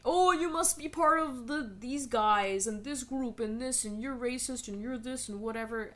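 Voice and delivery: lightly mocking an accusing critic. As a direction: parody the accuser. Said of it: "Uh, I want my games to have good writing".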